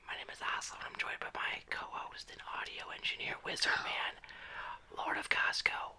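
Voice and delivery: hushed whisper intro. Pretend. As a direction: whisper it close to the mic. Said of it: "It's not whisper".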